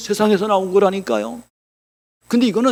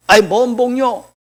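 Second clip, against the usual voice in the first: higher, drawn-out annoyed comeback; mock angry retort; 5 semitones higher, slower than usual. Old subway passenger rejects talk of blessing, mildly angry. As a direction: imitate an irritated, dismissive reply. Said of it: "이분이 화를 내면서요".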